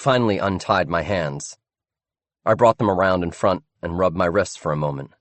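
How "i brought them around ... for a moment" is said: usual speaking voice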